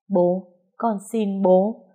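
slow, tearful begging; crying plea. Acted. Daughter begging her father, crying. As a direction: beg through tears. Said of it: "Nó khóc chạy lại ôm".

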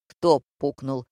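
low-key, carefully articulated question; unexpectedly distinct. A narrator's reading. Director questioning the silent stage, distinct. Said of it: "неожиданно внятно спросил режиссёр".

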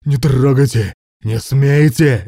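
high, loud, drawn-out screams; frantic screaming. A narrator's reading. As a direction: scream it out desperately. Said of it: "истошно завопил Руслан".

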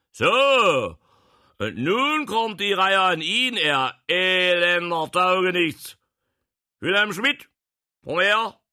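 high, loud, scolding bellow; angry commanding shout. Acted. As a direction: dress him down at full volume. Prince berates and orders the official. (zornig und herrisch gebrüllt)

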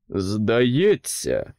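slow, loud growled opener; king snaps. A narrator's reading. King turns on the principles, a loud slow snap. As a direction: bark at the principles. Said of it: "гаркнув він".

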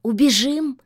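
hopeful, coaxing suggestion; eagerly hoping she agrees. Acted. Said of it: "с надеждой предложил кот".

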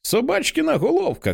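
put-on very high-pitched little girl voice; delighted child's cry. A narrator's reading. Little girl spotting the dog's head, delighted high cry. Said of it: "кричить у захваті Хейді".

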